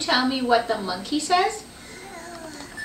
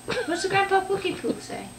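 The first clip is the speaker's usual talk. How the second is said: higher softer coaxing voice; sing-song question; 7 semitones higher, 4 dB quieter than usual. Shown as a demonstration. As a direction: lift it up to coax the toddler. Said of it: "repeats question in new voice".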